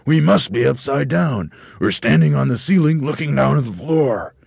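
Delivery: high, loud, astonished voice; aghast. A narrator's reading. Mr Twit works out they are on the ceiling, aghast. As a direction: exclaim it in dawning horror. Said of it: "gasped Mister Twit".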